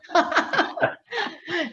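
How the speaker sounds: a high burst of laughter; bright high laugh breaking out; real laugh. Real, not acted. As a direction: laugh out at her own joke. Laughs at own joke.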